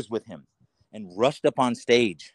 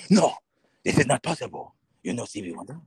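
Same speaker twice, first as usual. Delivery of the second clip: put-on French accent. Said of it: "This guy's name's Yusef".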